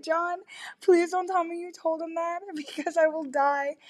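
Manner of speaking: high-pitched pleading through laughter; retold begging, squeaky with embarrassed giggles; mortified pleading. Woman begging John after he embarrassed her, mortified pleading.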